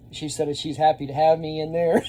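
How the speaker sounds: ordinary delivery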